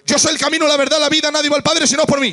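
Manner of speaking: confident declaration of Jesus' words; emphatic quote. Acted. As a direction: proclaim it boldly. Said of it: "Él siempre dijo".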